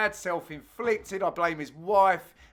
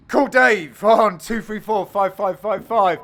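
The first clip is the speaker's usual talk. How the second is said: mock advert; 2 semitones higher, 9 dB louder than usual. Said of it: "announces the phone number as a mock ad".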